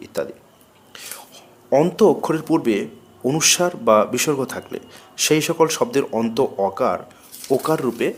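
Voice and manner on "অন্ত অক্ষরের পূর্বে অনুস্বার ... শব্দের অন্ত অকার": usual speaking voice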